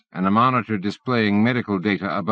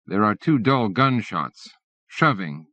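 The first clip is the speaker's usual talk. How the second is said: plain reading voice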